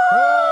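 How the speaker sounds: high, loud ah thrown to the corners of the room; loud sliding ah. Shown as a demonstration. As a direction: fill the whole room with one ah. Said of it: "Here we go".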